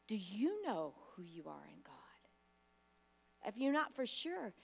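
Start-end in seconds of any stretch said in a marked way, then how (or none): 0.1-1.9 hushed whispered question. Acted. Preacher whispering the question to the congregation, hushed. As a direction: lean in and whisper it. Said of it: "preacher drops to a whisper".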